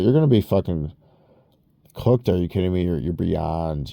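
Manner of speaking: usual speaking voice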